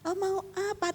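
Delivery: a soft, overly gentle demo reply. Shown as a demonstration. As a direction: coo it gently like a meek answer. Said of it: "tidak selalu harus lemah lembut".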